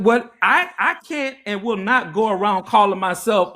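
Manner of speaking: ordinary talk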